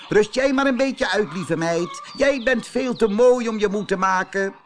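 high, loud, sugary rooster; flattering rooster. Acted. Rooster flatters pretty Marieke, high and loud. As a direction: sweet-talk the pretty hen.

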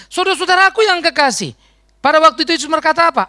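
loud, high call to the congregation; fervent preacher's call; preaching. Real, not acted. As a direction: lift it out to the whole church. Preaching at full force.

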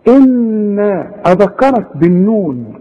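ordinary talk, nothing marked

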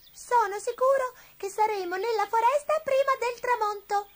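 very high little toy-horse voice; impatient wooden horse. A narrator's reading. Wooden horse pawing the ground beside Gobbolino, impatient. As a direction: squeak it out, champing to be off. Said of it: "aggiunse il cavallino di legno scalpitando".

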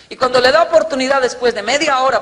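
usual speaking voice

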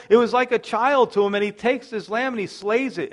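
high, loud storytelling sermon; preacher driving the parable home; fervent preaching. Acted. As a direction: build the parable with fire.

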